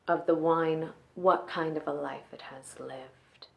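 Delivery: soft, measured femme fatale, lingering over key words; quiet, controlled dramatic monologue with long pauses. Acted. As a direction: let phrases sink almost to a whisper, like a dangerous woman confiding.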